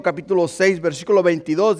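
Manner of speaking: ordinary delivery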